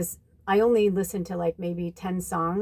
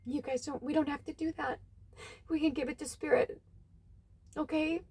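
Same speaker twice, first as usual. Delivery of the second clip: soft, hushed, higher comforting voice; tender reassurance; 4 semitones higher, 5 dB quieter than usual. Retold or imitated. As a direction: soothe a crying friend gently. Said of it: "she's like".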